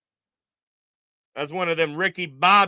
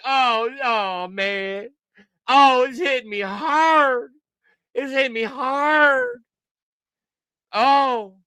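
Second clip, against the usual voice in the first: high, tearful fake wail; mock crying; 5 semitones higher than usual. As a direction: wail as if badly moved, hammed up. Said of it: "I'm gonna start crying with you".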